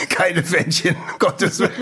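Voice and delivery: giggle, pretend to be shocked; laughing. Real, not acted. Mock horror at the idea.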